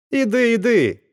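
the blacksmith yelling while he strikes; shout. A narrator's reading. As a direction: chase him off. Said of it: "кричав коваль".

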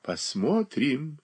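slow sly drawl; drawn out slyly. A narrator's reading. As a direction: stretch it out with a sly smile. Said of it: "протяжно проговорила Софья Андреевна с лукавой улыбкой".

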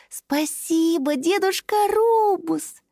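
put-on high, slow, thankful child voice; grateful little dragon. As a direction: thank the old tree sweetly. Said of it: "поблагодарила дерево синяя дракоша".

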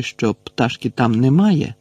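ordinary delivery, nothing marked

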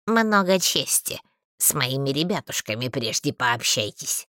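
mocking grumbler; scoffing. A narrator's reading. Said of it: "хмыкнул голос".

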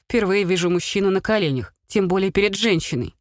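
plain reading voice

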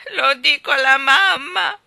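loud, high, slow wail; tearful. A narrator's reading. Ivy threatens to tell her mother, tearfully. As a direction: wail the threat through tears. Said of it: "disse Ivy tra le lacrime".

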